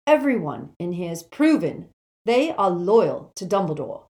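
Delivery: loud, emphatic appeal with pitch leaps; Neville, indignant and loyal. Acted. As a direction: argue it forcefully, hammering the key words.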